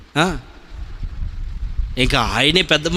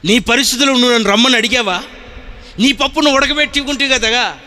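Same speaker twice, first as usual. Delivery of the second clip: fiery preaching; 8 semitones higher than usual. Acted. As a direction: preach it with full force to the congregation. Preaching at full force.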